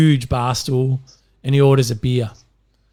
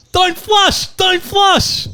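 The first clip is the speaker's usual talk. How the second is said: much higher frantic yell, repeated; panicked shout; much slower than usual. Acted. Blind man flailing in the pool, screaming in high panic. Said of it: "he shouts".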